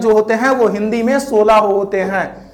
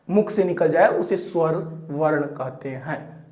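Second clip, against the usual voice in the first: soft; 7 semitones lower, 10 dB quieter than usual. Real, not acted. Teacher trails off softly.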